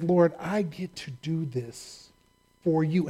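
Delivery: soft, slow thanks to the Lord; sincere prayer. Pretend. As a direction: murmur it like a humble prayer.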